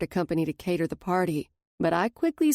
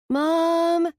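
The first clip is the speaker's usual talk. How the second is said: higher called-out Mom; calling out; 11 semitones higher, 5 dB louder than usual. A narrator's reading. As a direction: call from another room. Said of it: "Liv calls from her bathroom".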